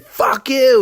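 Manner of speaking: high, loud shouted fuck you; retold defiant yell. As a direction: yell it right in his face. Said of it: "he said".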